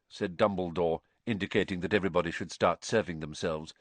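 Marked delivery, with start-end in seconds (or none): none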